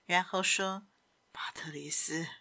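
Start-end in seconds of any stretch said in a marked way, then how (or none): 1.3-2.3 low, soft, half-whispered request; hushed Simon. Acted. Simon asking Patrice for privacy, hushed. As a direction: ask it low, for his ears only.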